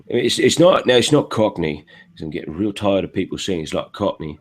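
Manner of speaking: common London accent, not quite Cockney; Londoner accent. Shown as a demonstration. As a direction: talk like a working Londoner. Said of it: "like Londoner".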